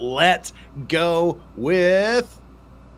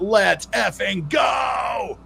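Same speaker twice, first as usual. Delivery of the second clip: stretched-out slow hype shout; rally the stream with a long yelled cheer; 7 semitones higher, much slower than usual. Real, not acted. Streamer hyping a super chat, drawn-out excited shout.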